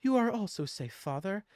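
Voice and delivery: sweet princess holding a secret; princess daughter. Acted. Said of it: "she looks down to him".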